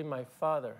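ordinary delivery

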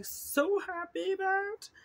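high, slow, squealy gush of joy; squealing delight. Real, not acted. Thrilled vlogger squeals over gnome stickers, high and slow.

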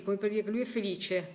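ordinary talk, nothing marked

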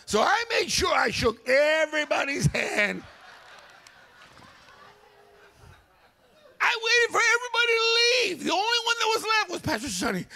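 high, loud shouted preaching; preaching at full force. Real, not acted. Preacher builds the story to his congregation, high and loud. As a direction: preach it to the rafters.

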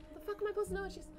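ordinary talk, nothing marked

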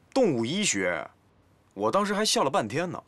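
usual speaking voice

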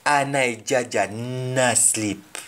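slowly, Anai jaja na sleep; slow Jamaican patois; slow patois demo. Shown as a demonstration. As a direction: lay out each word slowly. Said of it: "And then".

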